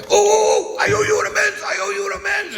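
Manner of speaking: very high, loud mock shout; frantic imitated outburst. Man busting through the crowd to the speaker, frantic shouting. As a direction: burst out in an overexcited, high-pitched holler.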